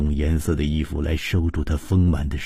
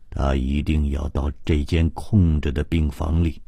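plain reading voice

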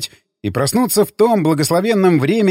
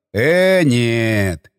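sly drawn-out no; 4 semitones lower than usual. A narrator's reading. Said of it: "Протянул Игнат и вложил в улыбку всю лукавую мудрость".

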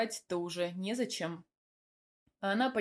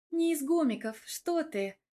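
higher-pitched earnest correction; mock serious; 7 semitones higher than usual. A narrator's reading. Surgeon corrects the joke about her patient, serious. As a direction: correct him with a straight face. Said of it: "серьёзно проговорила Велора".

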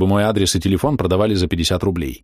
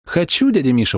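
eager exclaim; 7 semitones higher than usual. A narrator's reading. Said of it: "воскликнула Маша".

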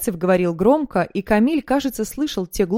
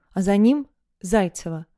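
plain reading voice

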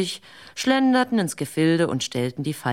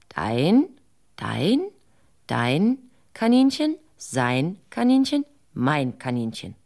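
slow sly sharing out; slower than usual. Acted. Wanderer tricks dunces, slow dividing.

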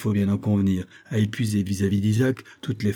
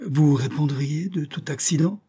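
quick-witted, higher-pitched eager question; 6 semitones higher than usual. A narrator's reading. Usurer asking about a guarantee, eager. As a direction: pounce on it with keen interest. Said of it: "demanda-t-il assez vivement".

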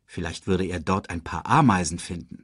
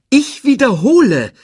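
high, loud, exasperated interruption; insistent interruption; 9 dB louder than usual. A narrator's reading. Timon interrupts the reunion, high and insistent. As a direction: break in loudly to repeat yourself. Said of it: "unterbrach Timon".

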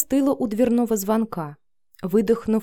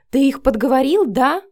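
louder, higher furious accusation; enraged; 6 semitones higher, 6 dB louder than usual. A narrator's reading. Vika accusing Matvey of setting her up, enraged. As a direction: throw the accusation at him hard. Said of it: "Вика озверела".

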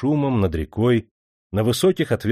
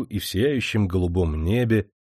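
ordinary delivery, nothing marked

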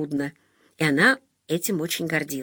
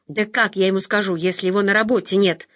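ordinary delivery, nothing marked

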